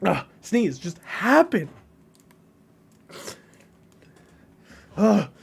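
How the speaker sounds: exasperated outburst, high and loud; fit of rage. Real, not acted. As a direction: beg furiously for it to come, voice jumping up. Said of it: "a fit of rage".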